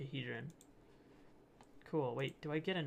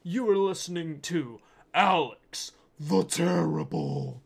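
put-on very loud, slow Russian-accented roar; hammed-up bellowing Russian frontman; 12 dB louder, slower than usual. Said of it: "in, like, a Russian accent".

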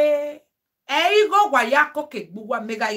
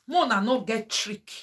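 ordinary delivery

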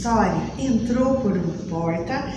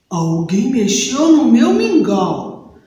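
papa bear; 7 dB louder than usual. Acted. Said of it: "Papai Urso disse".

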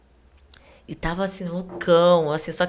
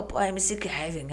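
softer, slow imitation of a thick-voiced man; imitated gruff voice; 9 dB quieter, slower than usual. Client announcing himself to the doorman, lightly imitated gruff voice. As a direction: lightly mimic his thick voice, slowly. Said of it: "aquela voz dele grossa".

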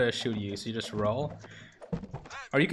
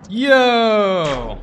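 excited shout; 8 semitones higher, 11 dB louder than usual. Real, not acted. Cheering a successful roll.

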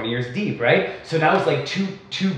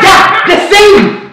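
drawn-out high shout, much louder; high, slow retold shout; 14 semitones higher, 11 dB louder, much slower than usual. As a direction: stretch it out at the top of your voice. Yelling in a drunken quarrel.

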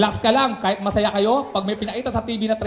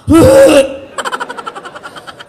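loud cackle; 11 semitones higher, 8 dB louder than usual. Real, not acted. After ad line.

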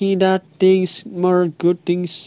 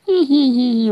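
a short, high-pitched squealing giggle; high squeaky giggle; 9 semitones higher than usual. Real, not acted. Gamer giggles high while his friend asks why he talks like that. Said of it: "Why you talking like that?".

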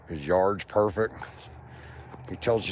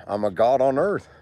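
usual speaking voice

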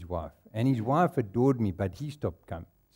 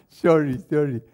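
laughing apology; 7 dB louder than usual. Real, not acted. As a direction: giggle out a sorry.

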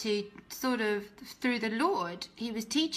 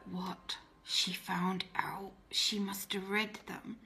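panicked inner whisper; 2 semitones lower, 6 dB quieter, slower than usual. Retold or imitated. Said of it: "And I was like".

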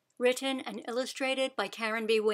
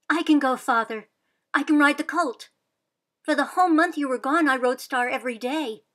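high, loud eager plea; girl pleading with father to let her ride; 4 semitones higher, 7 dB louder than usual. Acted. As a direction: beg to be allowed to go.

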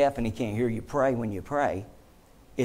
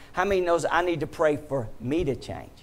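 ordinary delivery

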